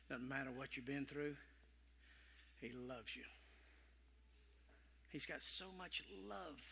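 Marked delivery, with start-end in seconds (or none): none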